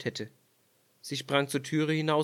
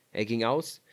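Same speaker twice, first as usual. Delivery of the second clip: plain reading voice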